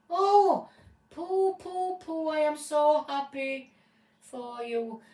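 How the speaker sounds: high, loud burst of joy; overjoyed cry. Acted. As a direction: squeal with delight at the news. Owner overjoyed dog is found.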